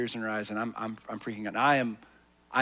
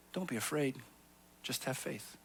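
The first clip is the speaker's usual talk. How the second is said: soft reassurance; 8 dB quieter than usual. Acted. Jesus's words, said softly.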